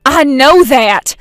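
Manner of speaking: loud, slow, irritated "I know that!"; snapping back. Acted. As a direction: bite back at being lectured. Irritated retort.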